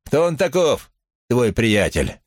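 high, falling shouted question; crowd heckler's call. A narrator's reading. Onlooker questions Brad about his friend, calling out high. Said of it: "крикнули из толпы".

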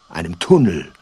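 ordinary talk

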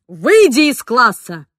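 loud slow angry command; teacher ordering a student out. A narrator's reading. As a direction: throw her out of the room.

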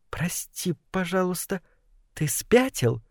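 hushed, disbelieving question; pointed clarifying. A narrator's reading. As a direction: ask very softly, as if checking his sanity. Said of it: "уточнила злокознь". (тихо, с недоверием уточняя)

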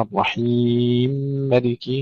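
slow الرحيم ملك fused, medium-long i; merged mim, tawassut. Shown as a demonstration. Teacher showing second option, medium madd in الرحيم ملك. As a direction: hold the ii of الرحيم moderately, merging into ملك. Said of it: "القصر والتوسط والمد".

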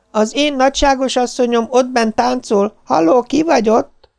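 very high maid's holler; blaring Róza. A narrator's reading. Maid fails to recognise her master on the phone, blaring. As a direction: call it out on a high, sustained pitch. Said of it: "harsant Róza hangja".

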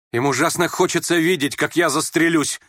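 high, heated retort; lashing out. A narrator's reading. Youth turning on his mocker, lashing out. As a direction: pounce on him with the words. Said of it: "точно накидываясь".

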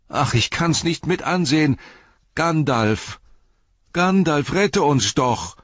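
high, anguished cry for rescue; desperate plea. A narrator's reading. Pippin begging absent Gandalf to save them, high desperate plea. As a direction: cry out as if you cannot bear to watch.

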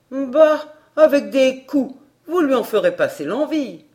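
put-on callous old woman. A narrator's reading. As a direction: brush it off cruelly.

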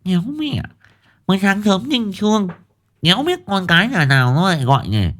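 high, lisping irritated grumble; a sleepy wife griping about early callers; grumbling wife. A narrator's reading. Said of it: "Vừa lẩm bẩm".

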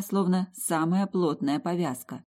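plain reading voice

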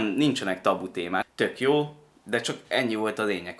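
ordinary talk, nothing marked

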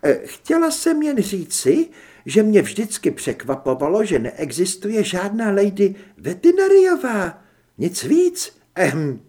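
high, flustered explanation; flustered, ending on an awkward hem; embarrassed lady. A narrator's reading. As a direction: backpedal awkwardly in a high feminine pitch. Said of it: "zatvářila se velmi rozpačitě".

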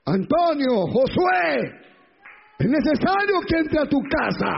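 nombres gritados más alto y fuerte; llama a cada uno con urgencia creciente; predicación a todo pulmón. Acted. (Predicador llamando nombres de la congregación, a gritos)

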